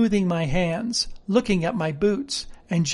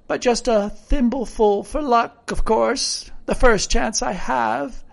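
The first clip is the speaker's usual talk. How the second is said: higher-pitched rustic sailor storytelling; earnest old castaway, pitched up; garrulous castaway character; 5 semitones higher than usual. Acted. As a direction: ramble piously like a marooned sailor.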